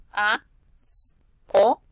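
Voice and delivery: pa, so broken into separate sounds, with a long sss; sounding out. Shown as a demonstration. Teacher reading pasó, sounding out pa, so. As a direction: sound out pa, so bit by bit, holding the s.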